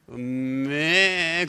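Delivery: slow drawn-out mooing; cow moo. Shown as a demonstration. Preacher jokingly asking if roosters moo, moo. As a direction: moo like a cow to tease the crowd. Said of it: "그렇게 웁니까?".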